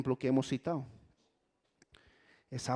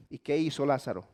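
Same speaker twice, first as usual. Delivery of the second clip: usual speaking voice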